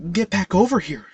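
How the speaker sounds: hissed plea. A narrator's reading. Anne hisses at Haley to get off the ledge, urgent. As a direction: hiss at her to come back, pushed hard. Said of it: "she hissed".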